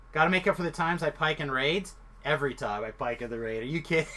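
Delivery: ordinary talk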